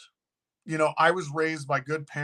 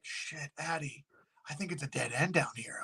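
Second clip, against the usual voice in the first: much softer, tense low murmur; hushed worry; 2 semitones lower, 11 dB quieter than usual. Retold or imitated. Driver to Addie in a bad street, hushed worry. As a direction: say it under your breath, uneasy.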